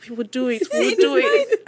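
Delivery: mock reassurance leaping into squeaky-high pitch; unconvincing support. Wife imitating husband who didn't mean it, overblown reassurance. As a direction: overdo his forced encouragement, laughing through it. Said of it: "Like, he didn't mean it".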